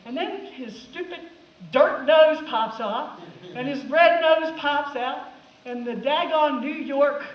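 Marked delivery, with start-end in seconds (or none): none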